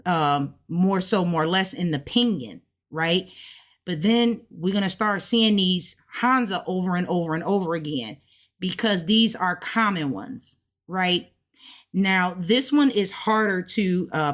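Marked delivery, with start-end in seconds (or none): none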